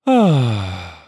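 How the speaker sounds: loud loose sigh on ah; just drop it into a sigh; relaxed sigh. Shown as a demonstration. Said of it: "Okay, one more time".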